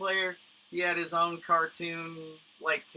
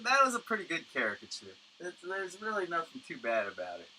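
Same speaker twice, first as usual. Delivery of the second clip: usual speaking voice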